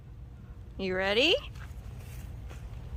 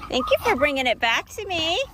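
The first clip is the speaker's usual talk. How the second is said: ordinary talk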